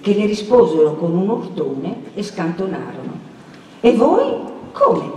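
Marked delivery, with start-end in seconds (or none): 3.8-5.1 high, startled little questions; taken aback at finding them here; astonished questioning. A narrator's reading. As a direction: surprise jumping up in pitch. Perpetua astonished at the other couple.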